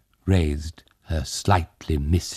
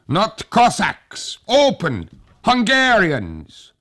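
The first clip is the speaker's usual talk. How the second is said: slow, loud soldier's shout, higher; shouting outside; 5 dB louder, slower than usual. A narrator's reading. Hussar shouts at the window to be let in, loud and slow. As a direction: bellow it through the shutters.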